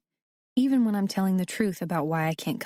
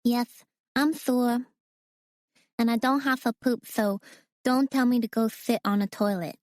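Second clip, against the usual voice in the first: put-on higher little boy voice, indignant; a small boy insisting he is sick; a hint of a huffy child; offended little boy; 5 semitones higher than usual. A narrator's reading.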